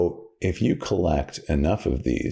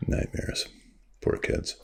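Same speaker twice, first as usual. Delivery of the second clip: whisper; 6 dB quieter, slower than usual. Acted. Mock concern under breath.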